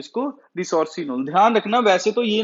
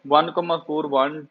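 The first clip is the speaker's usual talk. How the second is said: ordinary talk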